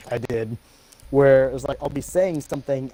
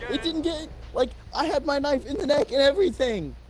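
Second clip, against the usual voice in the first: exasperated complaint; 10 semitones higher than usual. Real, not acted. Complaining teammate stole kill.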